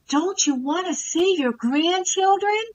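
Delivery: high, slow guilt-tripping coo; condescending baby talk. Retold or imitated. Coach guilting Angela about grandchildren, high slow baby talk. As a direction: imitate a coach talking down. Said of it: "I didn't like the way he spoke in his tone of voice".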